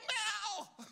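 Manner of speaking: high, loud cat-like squeal; playful loud meow. Retold or imitated. Grandparent playing with granddaughter, high playful meow. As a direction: yelp a silly meow for a grandchild.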